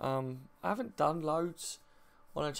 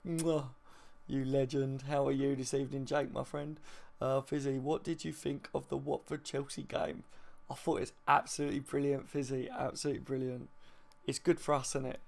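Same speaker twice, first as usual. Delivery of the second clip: ordinary talk, nothing marked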